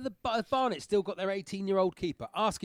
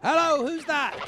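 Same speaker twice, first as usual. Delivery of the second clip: higher-pitched comic phone greeting; caller greeting; 7 semitones higher than usual. Acted. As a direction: call out hello down the line. Hosts act out phone call.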